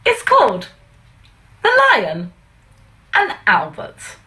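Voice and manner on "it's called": ordinary delivery, nothing marked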